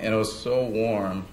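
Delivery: usual speaking voice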